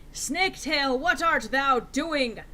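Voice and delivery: a hint of a loud, slow archaic call; Voldemort calling. Voldemort calling to Snaketail, lightly voiced. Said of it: "called Voldemort".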